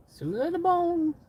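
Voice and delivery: high-pitched playful squeal; silly rhyme. Acted. Riffing on silicone rhymes, silly high voice. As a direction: send it way up in pitch.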